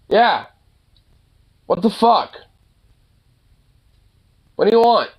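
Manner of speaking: high, short irritated bursts; irritated scolding. Real, not acted. Owner asks whining dog what it wants, irritated. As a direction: demand an answer, fed up.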